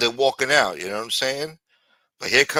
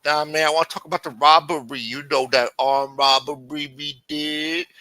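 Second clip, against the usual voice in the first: indiscreet insistence; 5 semitones higher, 6 dB louder than usual. Retold or imitated. Lameek pressing Hakeem about the robbery, loud high insistence. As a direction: spell it out obliviously in a raised voice.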